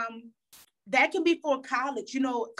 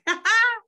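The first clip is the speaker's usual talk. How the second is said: burst of laughter; 11 semitones higher, 5 dB louder than usual. Real, not acted. Laughing after a powerful moment.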